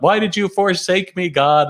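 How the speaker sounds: hammed-up desperate wail; mock scream. Man going over the waterfall cries out to God, mock high scream. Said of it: "he screams out".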